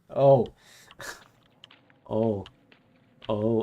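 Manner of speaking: loud sudden embarrassed oh; startled oh. Real, not acted. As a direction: jolt back, flustered. Embarrassed reaction to scene.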